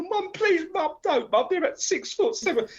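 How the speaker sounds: high, mock frightened little boy; a touch of a scared kid begging; pleading child. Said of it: "I go".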